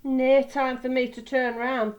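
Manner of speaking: loud, puffing engine voice; panting. A narrator's reading. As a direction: puff it out as if working hard. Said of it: "panted Douglas".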